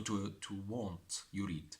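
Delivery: normal talking voice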